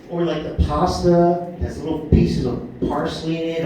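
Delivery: ordinary talk, nothing marked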